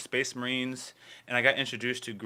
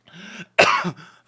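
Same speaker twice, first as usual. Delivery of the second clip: real loud cough; coughing fit; 7 dB louder than usual. Real, not acted. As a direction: cough hard, caught off guard. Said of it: "I'm literally coughing".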